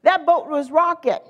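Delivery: normal talking voice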